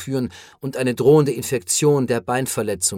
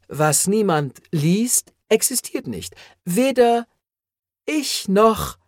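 higher, slow feverish raving; delirious raving; 8 semitones higher than usual. A narrator's reading. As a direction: rave in delirium, straining upward. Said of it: "bäumte sich auf und hauchte". (fiebriges Fantasieren, höher und langsam)

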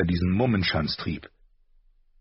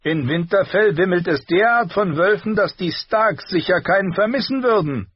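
put-on higher, louder sneering boy; mocking prince; 12 semitones higher, 6 dB louder than usual. A narrator's reading. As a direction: relish the insult. Prince mocks the Starks.

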